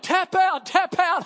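high, loud shout; shouted tap out. Retold or imitated. Fighter yelling at the pastor caught in an arm bar, shout. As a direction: yell it at him mid-hold.

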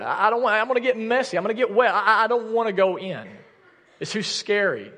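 put-on a teen whining to his mom that it isn't his job; whiny mock excuses. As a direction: pile on petulant excuses like a sulky teenager.

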